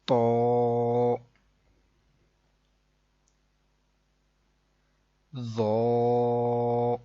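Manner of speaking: تو and ذو with heavy consonants, held round o; thick letters. Shown as a demonstration. Teacher models heavy letters, thick drawn-out تو, ذو. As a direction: fill the mouth on تو and ذو, sustaining each. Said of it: "موٹی آواز میں پڑھیں گے".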